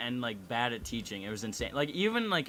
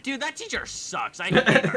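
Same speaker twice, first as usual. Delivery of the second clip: high-pitched acted gripe; student griping, voice pitched way up; venting complaint; 11 semitones higher, 8 dB louder than usual. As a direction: complain in a heightened, exasperated retelling. Acted complaint at lunch table.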